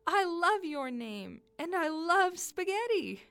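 high, loud glee; delighted, amused child. Acted. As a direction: gush it in sheer delight. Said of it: "Soleil howled with laughter".

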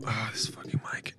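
whispered, annoyed at the mic. Real, not acted. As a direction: hiss it under your breath. Whisper, unvoiced.

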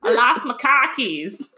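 hammed-up New England accent through laughter; northern accent. Shown as a demonstration. Performing the family's accent on request, broad northern accent. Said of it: "That northern accent".

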